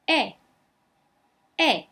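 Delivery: "E" as a French open é; French E sound. Shown as a demonstration. Teacher models French "E" for students. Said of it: "A mais I em francês tem um som de E".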